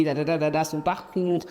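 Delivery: ordinary talk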